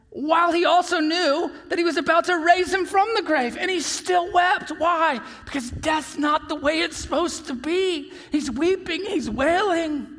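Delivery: high, loud, urgent pulpit voice; impassioned preaching. Real, not acted. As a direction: cry it out with full fervour. Preaching at full force.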